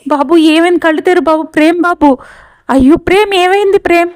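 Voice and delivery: distressed wife calling to unconscious Prem, panicked and high; panicked crying out. Acted. Heroine finds Prem unconscious.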